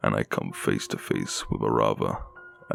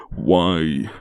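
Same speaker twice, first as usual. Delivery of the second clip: high, loud breaking cry; voice cracking; 6 semitones higher, 8 dB louder than usual. Acted. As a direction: let the voice break on it. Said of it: "my voice cracking".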